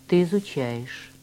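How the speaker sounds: ordinary talk, nothing marked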